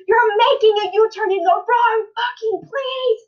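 loud, high furious yell at the wrong U-turn; angry driver yelling. Retold or imitated. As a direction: bark it out like an enraged driver. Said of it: "this driver just yelled at".

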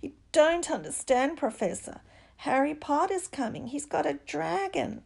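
high-pitched, urgent pupil's protest. A narrator's reading. Caught pupil tells on classmate to teacher, protesting. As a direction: plead your case in a high voice.

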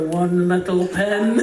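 slow, low, teasing reminder; needling uncle. Acted. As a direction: goad a nervous wife, drawing it out slowly. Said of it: "Just to really get her going".

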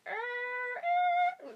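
high screeching arr arr in the wrong key; imitating subway screech, admitting it's the wrong key; imitated train screech. Shown as a demonstration. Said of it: "They go like".

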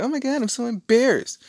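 mock squeal, very high-pitched; hammed-up teen mortification; embarrassed daughter.

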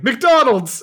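high, loud cry to the heavens; hammed-up shout at the sky; mock shout. Host imagining himself obeying the TV commercial, mock shout. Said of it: "raising my hands in the sky and shouting".

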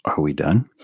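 low, flat deadpan; deadpan Ron cutting off the hearing. Acted. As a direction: end it, dry and bored. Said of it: "you're gonna be Ron".

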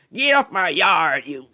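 put-on high, slow, loud Southern-accented old man yelling; southern drawl. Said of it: "I sound like a Southern American".

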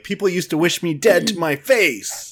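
put-on a light take on a smug old man; Pierce impression. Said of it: "Did you get a Pierce thing?".

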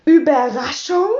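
"Überraschung" drawn up into a question; questioning echo. A narrator's reading. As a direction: repeat "Überraschung" doubtfully, ending on a rise. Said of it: "wiederholte Frau Rotkohl". („Überraschung“ fragend wiederholt, am Ende steigend)

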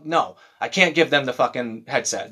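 ordinary talk, nothing marked

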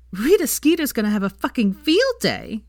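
admiring Veronica. Acted. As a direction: relish the coming scandal. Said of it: "said Veronica in admiration".

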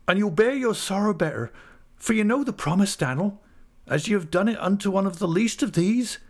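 put-on tearful old widow. Widow Mrs Gummidge soothing grieving Dan'l, high and tearful. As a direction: beg him gently to sit and stay. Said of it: "in a fit of crying".

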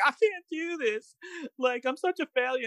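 very high, hammed-up whine; mock whiny trainee. Co-host mocking trainees who give up, high mock whine. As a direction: squeal it like a melodramatic quitter.

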